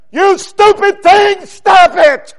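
high, loud scolding shout; God shouting down. Acted. God shouting down at his people, scolding shout. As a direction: shout it down hard like a divine rebuke. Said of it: "shout down at us".

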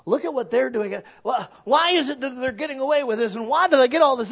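rising from complaint to loud, high indignant whine; envious whining. Retold or imitated. Preacher mocking his own envy of the neighbors, a whine building loud and high. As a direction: build envy into a shrill grievance. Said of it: "So when I'm sitting there going".

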